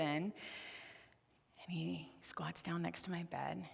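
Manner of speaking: ordinary talk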